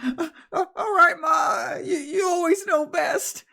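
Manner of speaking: high, shaky tearful voice; tearful Jeremy. Acted. Jeremy tearfully agreeing to go inside, high and sniffling. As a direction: sniffle through it like a crying boy. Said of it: "he goes".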